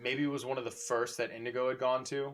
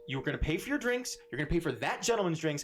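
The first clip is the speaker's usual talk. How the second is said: higher, firm barmaid ordering them out; stern barmaid; 7 semitones higher, faster than usual. Acted. Barmaid Mywin demanding they pay and leave, stern. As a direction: lay down the law.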